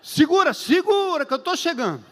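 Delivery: high, hurried call to hold on; urgent reply. Retold or imitated. Pastor urgently answers craving caller. (resposta urgente, aguda e apressada)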